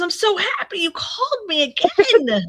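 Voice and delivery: high, slow, gushing fake joy; mock delight. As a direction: overdo the thrill of a spam call. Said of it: "he goes".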